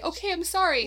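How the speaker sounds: high-pitched, playful giving-in; lightly hammed-up apology; mock apology. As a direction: give in with a high little sorry. Acting out an apology spiral.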